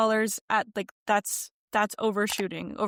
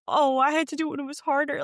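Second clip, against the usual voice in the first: higher, louder mock-grumpy complaint; grumpy old-timer; 6 semitones higher, 3 dB louder, faster than usual. Creator mocking those who resent easier monetization rules, grumpy. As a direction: put on a grumbler's whine, pitched up. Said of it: "I'm not gonna be the grumpy".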